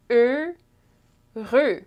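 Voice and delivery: "heureux" pulled apart into two syllables; syllable breakdown. Shown as a demonstration. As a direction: leave a gap inside "heureux", closed eu in both. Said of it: "two syllables".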